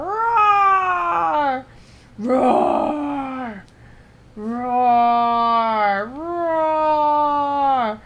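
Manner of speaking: loud growling beast roar; werewolf roar. Pretend. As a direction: roar like a monster on a rampage. Werewolf roaring in game.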